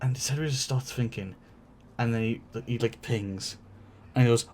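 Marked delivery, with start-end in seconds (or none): none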